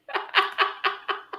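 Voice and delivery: high breathy disbelieving laugh; incredulous laugh. Real, not acted. Streamer laughing at a terrible metaphor, high laugh.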